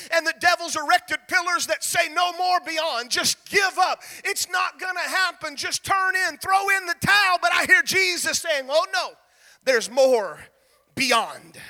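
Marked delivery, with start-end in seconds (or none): 2.1-7.4 taunting devil shout. Acted. Preacher voicing the devil to the congregation, a rising taunting shout. 8.7-11.6 gentle reassurance. Acted. Jesus answering the devil.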